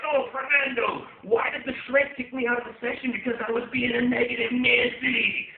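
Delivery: high, loud comic rant; yelling. Acted. Owner venting stress at his dog, comic yelling. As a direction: vent it as a hammed-up bellow. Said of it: "I bust in there and I'm just like".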